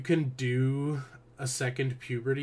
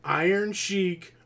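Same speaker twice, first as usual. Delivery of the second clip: excited announcer; 6 semitones higher, 4 dB louder, slower than usual. Pretend. Excited game-show style list.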